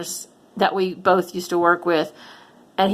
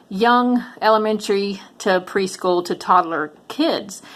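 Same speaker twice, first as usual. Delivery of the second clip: normal talking voice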